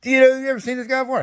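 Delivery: a hammed-up bark of command; the general to his soldier, mock stern and high; imitating the general. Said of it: "He goes".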